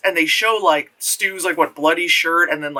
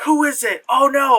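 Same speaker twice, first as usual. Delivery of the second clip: mock cheesy fright; 7 semitones higher than usual. Characters in cheesy scene.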